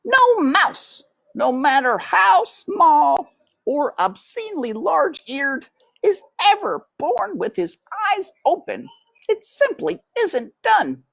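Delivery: put-on high, fussy old aunt voice; Aunt Florence. A narrator's reading. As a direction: squeak it out like a prim old aunt mouse.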